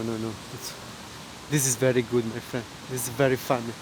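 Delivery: usual speaking voice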